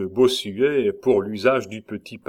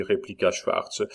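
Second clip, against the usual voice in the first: ordinary delivery, nothing marked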